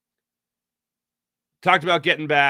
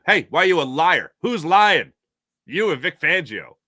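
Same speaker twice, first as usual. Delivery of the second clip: louder, pushy mock question; mock reporter grilling; 5 semitones higher, 6 dB louder than usual. Imagined reporter confronting the quarterback at the mic, loud mock grilling. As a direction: fire it at him like a reporter who smells a lie. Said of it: "Next time".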